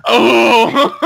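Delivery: surprised shout. Real, not acted. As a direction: blurt it out as it finally clicks. Sudden realisation, shouted.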